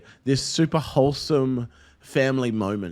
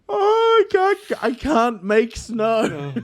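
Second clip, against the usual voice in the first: helpless dad; 9 semitones higher, much slower than usual. Retold or imitated. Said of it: "I'm just like".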